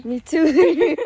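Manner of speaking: normal talking voice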